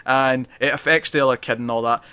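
ordinary talk, nothing marked

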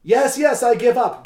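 high, loud, breathless giving in; hammed-up beaten wrestler; mock gasped surrender. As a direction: gasp it out as if pinned. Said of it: "gasped Dufour".

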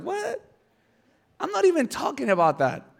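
very high, incredulous "what"; mock bafflement. Jesus hears her change the subject, comically baffled. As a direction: squeak the question, then protest.

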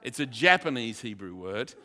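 usual speaking voice

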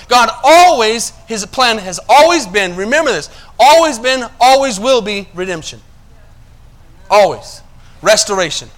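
impassioned preacher; preaching. Acted. Sermon building to emphasis.

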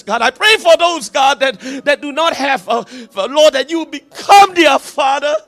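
preaching at full force. Acted. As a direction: drive the prayer home with full force, voice breaking at times. Prayer builds to full-force preaching.

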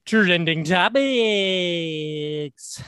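very slow, stretched announcer voice; drawn-out announcer. Pretend. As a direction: stretch it out like a show jingle. Segment name, stretched out slowly.

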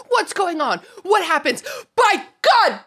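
high, loud panicked cry; screaming Fudge. Retold or imitated. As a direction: shout the news to the crowd. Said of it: "screams into the masses".

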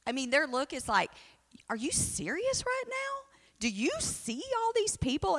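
high, disbelieving mock disciples; incredulous disciples. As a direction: play the stunned disciples, pitch way up. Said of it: "they're like".

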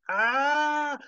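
high, rising long scream; villain's death scream. Acted. Chet is ripped apart by the rift, screaming. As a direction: scream as you are torn apart.

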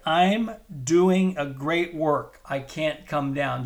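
slow, every word weighted; slow emphatic declaration. Acted. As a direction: proclaim it firmly, word by word. Declaration repeated.

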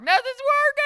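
high loud wail; crying. Retold or imitated. Telling them pain pills failed, crying wail. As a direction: burst into a despairing howl. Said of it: "Cried and cried and cried".

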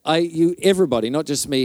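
normal talking voice

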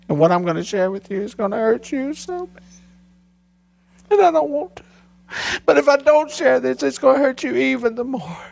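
a father begging her to hear him; pleading through tears. Retold or imitated. Pastor telling his daughter hard news, voice cracking high.

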